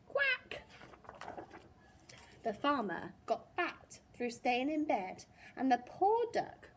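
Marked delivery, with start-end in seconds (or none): 0.1-0.6 short, high-pitched quack; duck's quack. A narrator's reading. Duck answering the lazy farmer, high quack. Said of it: "And the duck answered".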